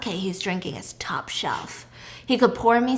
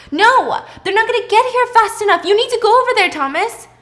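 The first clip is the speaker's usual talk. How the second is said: much higher and louder, pushing him to go; urgent command; 10 semitones higher, 6 dB louder than usual. Retold or imitated. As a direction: urge him on in a panic. Frantic quarrel over choking neighbor.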